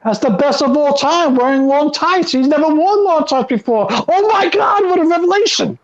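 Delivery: high-pitched, over-excited superfan; mock gushing. Co-host parodying fans thrilled by Punk's long tights, mock gushing.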